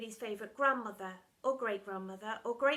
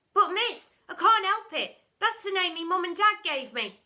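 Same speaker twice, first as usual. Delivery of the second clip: higher, loud whiny protest; protesting child; 7 semitones higher, 11 dB louder than usual. Acted. Pupil Mark protesting his name, whiny. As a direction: whine it like a wronged kid.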